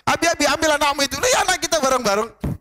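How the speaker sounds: high-pitched pretend squabble; mock marital annoyance. Wife tells husband to take his child, mock annoyed. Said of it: "Tapi kadang kita marah begitu".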